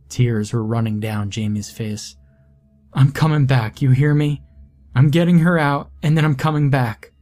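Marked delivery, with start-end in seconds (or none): 2.9-7.1 louder, higher desperate promise; desperate vow. Acted. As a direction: call the promise back to him. Desperate promise to trapped brother.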